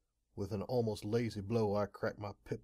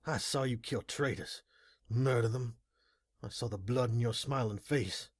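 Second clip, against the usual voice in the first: raised, strained, accusing voice; anguished confrontation; 4 dB louder than usual. A narrator's reading. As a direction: hurl the grief at him. Confronting brother over killings.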